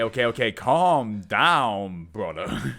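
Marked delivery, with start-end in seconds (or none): none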